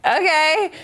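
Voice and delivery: high, rising okay; eager mock agreement. She recalls answering his invitation, high and rising. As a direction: agree in a squeaky-high imitation of yourself.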